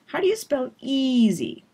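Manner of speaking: "how do you spell" slurred quick, "easy" stretched out; stress on easy. Shown as a demonstration. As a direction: lean into the first vowel of "easy". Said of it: "So easy is the peak word".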